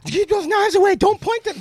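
high-pitched imitation of a nagging wife; hovering wife. Wife warns husband off the knives, high and fussing. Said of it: "She's like".

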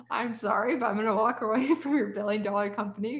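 retold apology broken by a laugh; amused disbelief. Imagining telling an uncle no to his company, amused disbelief. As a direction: laugh through a mock apology, a little lifted.

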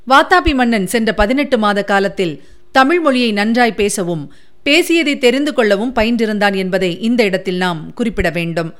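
plain reading voice

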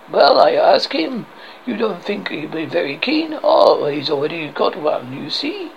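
comic French guard voice; outrageous French accent. Acted. French guard turns down Arthur's quest, outrageous French accent. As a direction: put on a silly French accent. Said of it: "I have this outrageous accent".

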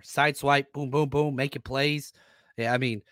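ordinary talk

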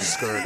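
"scared" pronounced "skurd"; slangy "scurred". Shown as a demonstration. Co-host savoring his favorite word, "scared" said as "skurd". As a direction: say "scared" to rhyme with bird. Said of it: "I've always wanted to say that word".

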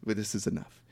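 low, very soft mock solemnity; hushed, deep earnest voice; solemn film characters. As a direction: imitate quiet, grave acceptance. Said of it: "And then they're like".

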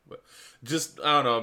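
ordinary talk, nothing marked

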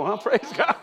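ordinary talk, nothing marked

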